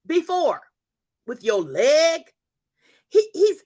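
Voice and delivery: rising fervent preacher's exhortation; preaching. Acted. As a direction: preach it to the congregation with conviction. Preaching to her viewers.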